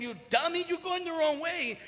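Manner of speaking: loud, high mock yell at the player; teammates yelling. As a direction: yell it like the whole team is shouting. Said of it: "everybody told him".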